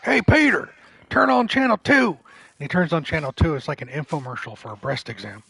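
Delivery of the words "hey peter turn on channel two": loud, high bellow, each call hammered; yelling through the walls. Retold or imitated. Construction worker neighbor calling Peter through the wall, loud yell. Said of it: "he'll hear just yelling through the walls".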